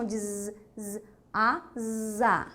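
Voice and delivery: isolated buzzing "z", then "asa" with a long voiced z; sound of Z. Shown as a demonstration. Teacher revealing the sound in asa, buzzed Z. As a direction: draw "asa" out slowly around the buzz. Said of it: "qual é o som da palavra asa?".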